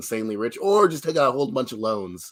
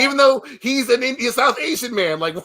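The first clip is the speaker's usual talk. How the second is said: excited rant; 11 semitones higher than usual. Real, not acted. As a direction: pitch up high, indignant and fast. Ranting about casting.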